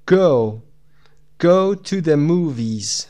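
normal talking voice

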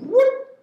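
high-pitched whoop for a swift movement; quick whoop. Shown as a demonstration. Coach showing a quick kettlebell lift, high whoop.

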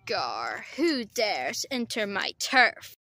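low, slow monster growl; trash monster voice. Pretend. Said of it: "who's that talking?".

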